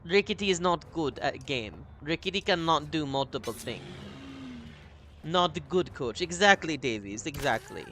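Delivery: lightly put-on Russian accent, deadpan; broken-English Russian gamer, playful; a touch of a mock Russian accent. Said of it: "I think I'm done with the accent now".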